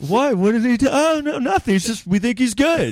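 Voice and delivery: very high, hammed-up innocent denial; squeaky-high feigned innocence with laughter creeping in; mock defensive exec. Mock exec covering up the affair.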